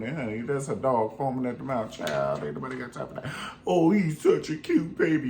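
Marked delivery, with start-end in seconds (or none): 3.6-5.3 high cooing over a dog; mock baby talk. Mocking a stranger cooing at a loose pit bull, high baby talk. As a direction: coo like someone about to pet a mean dog.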